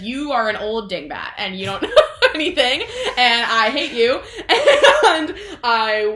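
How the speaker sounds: mock rant dissolving into laughs; laughing insult. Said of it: "She's like".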